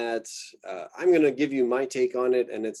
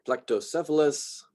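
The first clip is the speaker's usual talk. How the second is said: plectocephalus with the beat on CEPH; stress on third-to-last syllable; 4 semitones higher than usual. Shown as a demonstration. Lecturer showing students genus names, antepenultimate stress on plectocephalus. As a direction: lift CEPH in plectocephalus, two light syllables after. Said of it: "pronounce that as the emphasized syllable".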